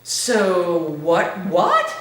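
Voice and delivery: startled squeak jumping way up on the last what; retelling a shocked reaction, voice shooting up; freaked out. Retold or imitated. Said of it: "it kind of freaked me out".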